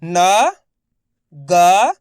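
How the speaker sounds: न and ग called out loud one by one; letters spelled out. Shown as a demonstration. Teacher spells न and ग aloud for pupils. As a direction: sound out each letter clearly. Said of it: "चलिए देखिए".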